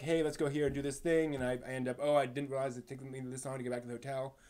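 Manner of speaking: usual speaking voice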